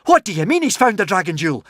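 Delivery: fast, high puzzled question; bewildered. A narrator's reading. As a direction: ask in sheer confusion. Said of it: "in a bewildered sort of way".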